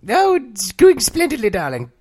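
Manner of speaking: high, slow, plummy diva drawl; Felicity Bloody Dump. Pretend. Greeted by a friend, Felicity answers in a high slow posh voice. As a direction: purr it out like a grand society lady. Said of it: "Hey, Felicity".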